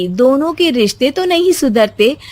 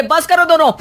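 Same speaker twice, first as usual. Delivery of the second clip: husband stopping quarrel; 6 semitones higher than usual. Acted.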